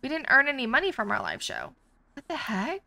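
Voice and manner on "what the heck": indignant disbelief. Real, not acted. Outrage at earning no money.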